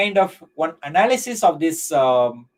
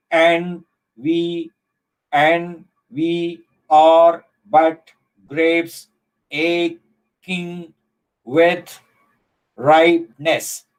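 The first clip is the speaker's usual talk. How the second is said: slow, evenly spaced poem reading; syllable by syllable; much slower than usual. Shown as a demonstration. Teacher analysing the whole poem with students, syllable by syllable. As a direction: count it out with a gap after each syllable.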